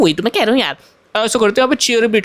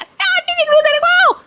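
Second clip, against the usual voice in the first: offended aunty voice. Acted. Aunty scolds the boy.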